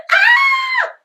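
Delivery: very high, loud scream; shriek. A narrator's reading. Peter shrieking as Henry lunges, very high. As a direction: scream as if grabbed. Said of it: "shrieked Peter".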